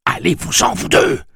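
loud, high angry dismissal; grondement intérieur. A narrator's reading. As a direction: order both voices out, shouting. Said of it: "gronda-t-il intérieurement". (ordre crié avec colère)

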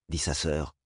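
plain reading voice

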